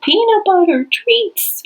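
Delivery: very high, loud, stretched-out holler; re-enacted yell. Finally yelling to the runaway dog, high drawn-out yell. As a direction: holler it slowly to lure a dog back. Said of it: "then I finally yelled out".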